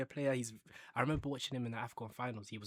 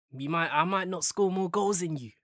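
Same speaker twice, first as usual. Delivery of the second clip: louder, higher boastful challenge; challenging shout; 7 semitones higher, 11 dB louder than usual. Pretend. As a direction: throw down the gauntlet loudly. Challenging rival striker.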